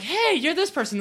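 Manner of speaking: high, eager calling out; excited recognition. Retold or imitated. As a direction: spot a fan and call out happily. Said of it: "there were a few costumes like".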